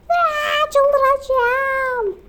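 mother losing it again; frustrated shriek. Acted. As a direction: shriek it, out of patience.

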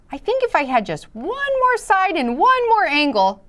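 high, wistful little shape voice; wishful shape voice. Acted. Quadrilateral voiced wishing for more.

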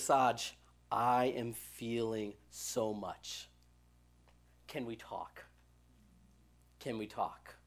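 put-on quiet, low and slow, like a troubled teen; lightly voiced teen murmuring can we talk; soft, slow plea. Teen Carlos asking his pastor to talk, quiet slow plea.

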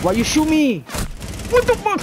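high shrieking yell; startled yell. Real, not acted. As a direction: shriek in shock. Shot unexpectedly in game.

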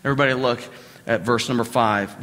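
ordinary talk, nothing marked

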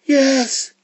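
very high iguana squeak; iguana agreeing. Acted. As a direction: confirm it in a high creature voice. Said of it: "said the iguana".

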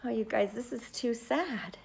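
plain reading voice

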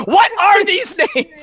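baffled. Real, not acted. Player confused by fantasy names, high and slow. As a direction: sound totally bewildered.

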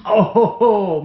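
high breathless laugh, then a loud oh; real burst of laughter at the strike; delighted laugh. Real, not acted. Keeper laughing out loud at a good strike. Snake strikes after long wait.

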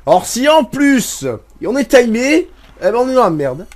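high, loud and slow, drawn-out complaint; hammed-up grumble about the timer; exasperated rant. Real, not acted. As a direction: stretch it out in mock despair. Mock exasperation at timed race.

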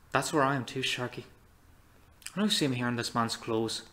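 ordinary talk

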